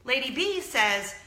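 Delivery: ordinary delivery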